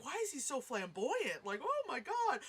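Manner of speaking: rising to high-pitched disbelief; playful incredulous wail; retold bafflement. Retelling own baffled reaction.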